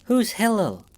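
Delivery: high, nervous question; fearful curiosity. A narrator's reading. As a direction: ask while afraid of the answer. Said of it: "the fearful curiosity in her voice".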